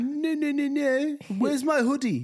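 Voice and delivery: put-on high whiny little-kid voice; child's whine. Dad imitating the son whining about his hoodie. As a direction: whine like an upset toddler.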